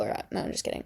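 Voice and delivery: breathy whispered "just kidding"; whispered take-back. Real, not acted. Backing off her joke to viewers, whispered.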